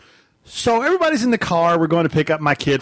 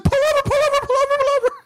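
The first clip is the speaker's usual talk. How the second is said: very high, frantic mock panic; imitated screaming; faster than usual. Wife urging the driver to stop, mock panic. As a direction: plead over and over in a squeal. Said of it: "when she started screaming".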